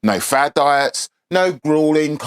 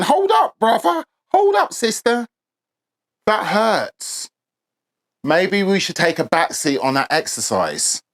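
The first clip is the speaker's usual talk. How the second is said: high, pleading inner voice; voice of your body; 5 semitones higher than usual. Acted. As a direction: play your body begging you to stop. Body's voice telling you to stop.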